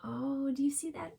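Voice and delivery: ordinary talk, nothing marked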